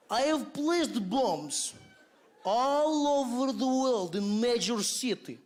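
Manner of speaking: slow heavy mock Russian accent; Russian villain Boris. Terrorist Boris threatening the United Nations, hammed-up Russian accent. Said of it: "And then Boris comes on".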